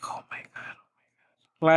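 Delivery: breathless unvoiced whisper of disbelief; exasperated whisper. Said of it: "And I'm just like".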